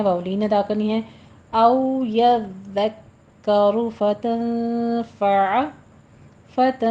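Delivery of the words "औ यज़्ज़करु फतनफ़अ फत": फतनफ़अ with the नून hidden and nasal, held two counts; tajweed demo. Shown as a demonstration. Teacher shows ikhfa, slow. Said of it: "यहाँ पे इख्फा कीजिए".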